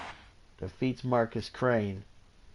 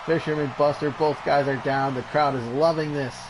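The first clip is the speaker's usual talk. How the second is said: lively ringside wrestling call; energetic play-by-play over a brawl; play-by-play commentary; 3 semitones higher, 3 dB louder than usual. Acted. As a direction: call the match like a TV announcer. Live wrestling game play-by-play.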